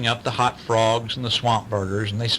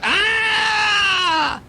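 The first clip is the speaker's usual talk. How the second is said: sharp, high, loud "Ah!" yelp; storybook teacher yelping when stepped on; high yelp of pain; 3 dB louder than usual. Acted. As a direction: yelp as if a foot got stepped on. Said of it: "And she went".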